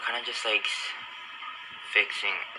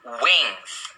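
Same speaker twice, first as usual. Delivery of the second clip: mock product voice; 3 semitones higher than usual. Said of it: "she said".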